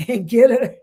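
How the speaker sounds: words dissolving into giggles; laughing through the words; breaking into laughter. Real, not acted. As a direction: crack up before finishing the phrase. Cracks up over silly putty.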